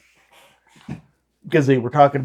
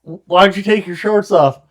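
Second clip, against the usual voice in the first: mock questioning; 6 semitones higher, 5 dB louder than usual. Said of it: "retold exchange".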